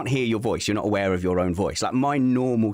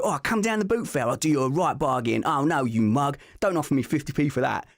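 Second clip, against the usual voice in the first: higher, haggling Essex market patter; imitated Essex accent; 5 semitones higher than usual. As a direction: mimic an Essex boot-fair trader. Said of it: "when I try and imitate".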